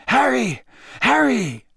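high, loud urgent calling of a name. Acted. Dumbledore calling out to Harry on the ground, urgent. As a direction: call him back to his senses.

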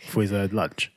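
ordinary delivery

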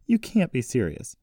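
a touch of indignant disbelief; exclaiming. A narrator's reading. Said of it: "Jessie exclaimed as she stood up".

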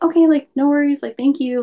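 lightly acted, high-pitched politeness; imitating a breezy, obliging reply; agreeable reply. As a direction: pitch up, easygoing compliance. Retold compliant reply to police.

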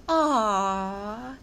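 long, loud, drawn-out cooing aww; adoring coo. Acted. Claudine rushing to the baby donkey, gushing coo. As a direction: coo it out over something adorable.

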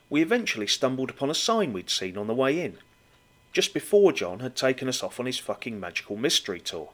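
normal talking voice

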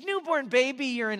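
high, loud aunt voice; Aunt Peggy. Retold or imitated. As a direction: repeat it firmly in a high mimicking voice. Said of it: "this is how she talked".

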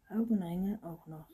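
cried out. A narrator's reading. Anton hears he also needs dark eye rings, crying out. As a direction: call it out in dismay. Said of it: "rief Anton".

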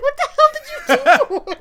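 high, loud outraged mom; imitated mother's high-pitched yell; mock shocked yell. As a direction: screech it as an appalled mom, half laughing. Retelling mom's shock after the crash.

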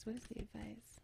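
very soft hushed voice; whispering. Real, not acted. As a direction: barely breathe the question. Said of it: "have to whisper a little bit".

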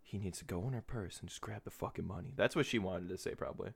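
hushed, secretive whisper; soft conspiratorial whisper of grandma's true thoughts; imagined grandma's inner voice. Retold or imitated. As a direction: whisper it like her hidden thought. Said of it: "What she really was thinking".

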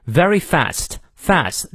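slow model, "fast" with the open a of "cat"; American "fast", front A held clearly; American short A. Shown as a demonstration. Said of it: "fast注意是梅花哎".

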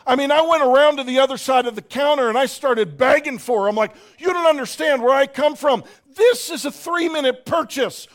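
hammed-up shout. Preacher acting out begging the cashier, mock shouting. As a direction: play up the frantic shopper.

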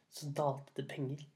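ordinary delivery

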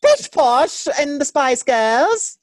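very high, loud hammed-up posh British; mock posh British. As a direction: play a snooty Brit.

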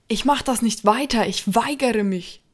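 high, loud refusing shout. Acted. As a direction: scream your refusal. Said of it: "schrie André herum".

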